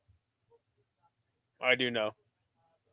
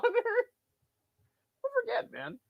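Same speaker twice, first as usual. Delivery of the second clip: much higher, very slow stretched-out voice; mock high drawn-out repeat; 5 dB quieter, much slower than usual. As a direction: stretch People forget into a squeaky mocking echo. Repeats line then parodies it.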